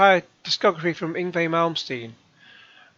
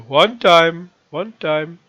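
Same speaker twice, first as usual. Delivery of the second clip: loud, badly faked Jamaican accent; punchy mock Jamaican hook, easing off on the repeat; poor Jamaican accent; 3 semitones higher, 7 dB louder, much slower than usual. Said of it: "Jamaican accent".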